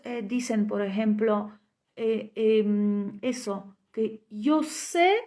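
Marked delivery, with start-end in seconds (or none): none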